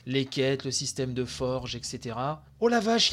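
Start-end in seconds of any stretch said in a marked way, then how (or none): 2.6-3.1 excited gasp. Real, not acted. Excited reaction to 2D version.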